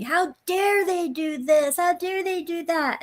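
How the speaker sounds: high, slow, mocking indignation; a hammed-up pearl-clutching "how dare they"; mock outrage. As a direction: parody offended internet commenters. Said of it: "internet is blowing up saying".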